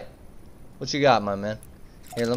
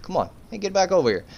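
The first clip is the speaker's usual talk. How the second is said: ordinary talk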